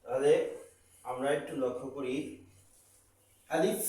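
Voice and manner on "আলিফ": ordinary talk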